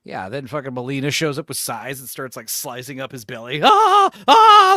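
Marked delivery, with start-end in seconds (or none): 3.6-4.7 high, loud shrieks of agony; pained screams. Pretend. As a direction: scream like being tortured. Newscaster being sliced up.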